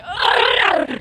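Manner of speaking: high, exaggerated "ugh"; frustrated groan. Player imitating being hit on the offbeat, frustrated groan. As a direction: act out a thrown-off groan. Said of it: "and you're like".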